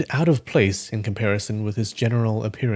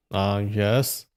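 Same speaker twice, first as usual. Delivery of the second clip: cold; 2 semitones lower, 5 dB quieter than usual. Acted. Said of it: "said the waiter coldly".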